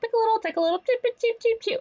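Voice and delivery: very high clucking cheep chant; hammed-up hen chatter; chicken ladies. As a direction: cluck like gossiping hens. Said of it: "sounds like they're, like, chickens".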